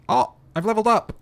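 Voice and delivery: high, loud delighted outburst; excited surprise. Real, not acted. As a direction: burst out with sudden joy at leveling up. Surprised by level up.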